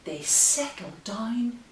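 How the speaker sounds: gentle sing-song rhyme reading; reading to children. Acted. Bedtime reading the rhyme aloud to her baby. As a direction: read the rhyme like a lullaby.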